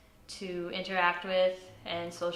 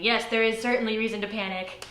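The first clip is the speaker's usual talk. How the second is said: louder, higher, emphatic retort; mock inner scream; 4 semitones higher, 6 dB louder than usual. Her mind answers her attempt to calm down, mock screaming. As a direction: raise the voice like a panicking inner thought. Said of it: "in my mind is screaming".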